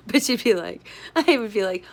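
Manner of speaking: ordinary talk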